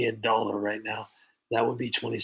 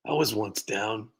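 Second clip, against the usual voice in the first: slow, deliberately earnest redo of the line; mock solemn retake. Streamer re-reads the scammer's line in slow mock earnestness. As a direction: say it again slowly, straight-faced, mocking sincerity.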